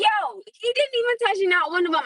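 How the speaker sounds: high, indignant complaint; exasperated disbelief. Retold or imitated. Mom complains her son never ate the grapes, high indignant disbelief. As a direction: let the outrage spill over. Finding uneaten grapes in fridge.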